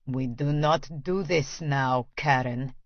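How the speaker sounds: low, slow Russian menace; menacing. Acted. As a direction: threaten her quietly, slowly. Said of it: "he says in a menacing voice".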